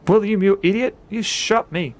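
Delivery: loud, high angry outburst; angry growl. Acted. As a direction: snap furiously at the friend who shot you. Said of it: "Frank Bates growls in anger".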